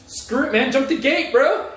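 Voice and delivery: high-pitched imitation of an urgent friend; retold urging.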